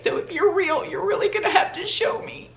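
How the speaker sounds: high, strained pleading prayer; tearful plea. Retold or imitated. Woman on her knees begging God, tearful and high. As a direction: beg God through tears.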